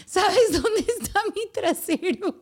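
put-on high, slow kid's call, laughing through it; mock child yelling. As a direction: yell it like the kid upstairs. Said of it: "básicamente me grita".

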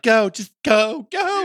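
high, drawn-out mock plea; Elizabeth. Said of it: "And then Elizabeth's like".

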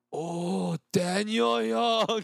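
high, slow starstruck gasp at a celeb; starstruck fan. Retold or imitated. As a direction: gush like a drunk fan meeting his idol. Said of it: "he started freaking out".

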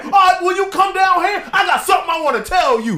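high, loud-edged mock challenge; goading prankster. Prank caller daring the victim to come, mock goading. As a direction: egg them on in a high voice.

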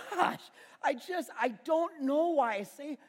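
ordinary delivery

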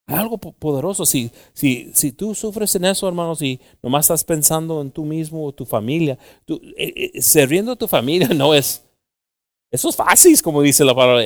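fiery preaching. Real, not acted. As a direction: lift the congregation with rising fire. Preaching rising to a peak.

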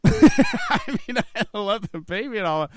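high laugh running into the words. Real, not acted. Confessing to a friend about wanting sleep, laughing. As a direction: protest through real laughter.

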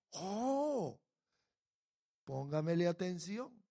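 ordinary delivery

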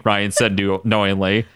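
usual speaking voice

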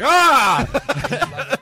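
loud, high, drawn-out "ah!"; mock scream. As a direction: let out a hammed-up scream of horror. Mock reaction to her looks.

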